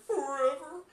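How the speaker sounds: high shouted forever; yelled forever. Retold or imitated. Fan imitating Mister Krabs, screamed. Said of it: "and so it's like".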